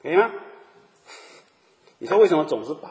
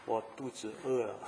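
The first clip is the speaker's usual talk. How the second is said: softer, imitating a bedridden patient; barely there, a patient too weak to speak; weak sick man's murmur; 3 semitones lower, 10 dB quieter than usual. Preacher imitates a weak hospital patient, soft and faint. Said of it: "他就很小声地跟你说".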